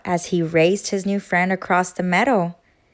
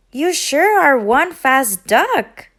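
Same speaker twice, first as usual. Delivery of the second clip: louder, slow call after her; 5 semitones higher, 5 dB louder, slower than usual. Acted. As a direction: call it to someone running off. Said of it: "Duck called after her".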